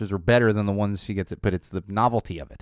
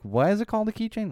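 put-on questioning kid; 12 semitones higher than usual. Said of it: "eventually get back to like".

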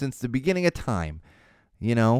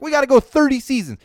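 high, loud overexcited imitation; mock eagerness; 12 semitones higher, 6 dB louder than usual. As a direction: blurt it like an overambitious show-maker. Mocks shows copying The Simpsons' long run.

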